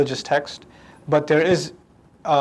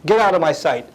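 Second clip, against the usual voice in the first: ordinary talk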